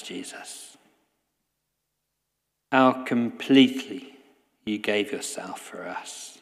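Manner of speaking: hushed heartfelt prayer. Acted. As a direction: pray it quietly, each name Jesus falling to a breath. Leads a closing prayer.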